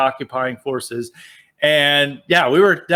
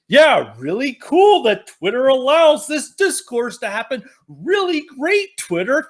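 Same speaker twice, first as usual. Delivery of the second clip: passive aggressive sarcasm; 9 semitones higher than usual. Retold or imitated. Chris Hayes sarcastically thanking Twitter, passive aggressive and high. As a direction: sneer it with fake gratitude. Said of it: "the most bitchy, passive aggressive manner".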